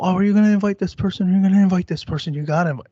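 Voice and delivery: high, loud nagging mom voice; a son imitating his mother's nagging; mimicking mom. Mom nagging about invites, mimicked.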